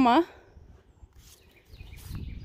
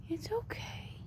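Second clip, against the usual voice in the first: soft, reassuring whisper; 6 semitones higher, 13 dB quieter than usual. Real, not acted. Soothing the baby animal.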